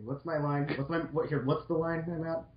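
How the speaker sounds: ordinary talk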